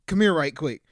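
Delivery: loud come-here call, calling him over; beckoning a kid across the room; mom calling out. Retold or imitated. Calling Isaiah over loudly, imitating the mom. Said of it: "I've never heard anybody say "right quick".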